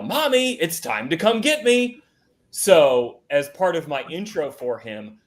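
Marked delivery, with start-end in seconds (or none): 0.0-2.0 put-on high, shouted kid's wake-up call; toddler yelling. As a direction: yell it like a small child calling mom. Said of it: "he would yell".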